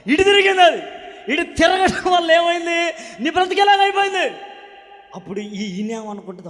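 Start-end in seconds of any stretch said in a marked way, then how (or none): 0.1-4.4 high, loud dramatic reveal; hammed-up palm-reader; mock fortune-teller. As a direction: ham up the palm-reader's doom. Mocking the fortune-teller's reading.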